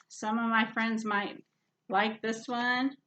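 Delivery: ordinary delivery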